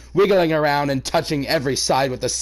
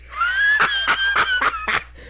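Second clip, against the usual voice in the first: shrieking laugh. Real, not acted. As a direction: crack up hysterically. Reader laughs hysterically.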